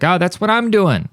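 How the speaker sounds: high-pitched retold excitement; excited recognition. Listener recognizing himself in podcast advice, excited. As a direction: jump up high as if it clicks.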